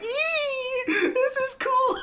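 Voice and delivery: put-on very high, slow squealing kid voice; excited child's squeal. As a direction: squeal it like a thrilled small child.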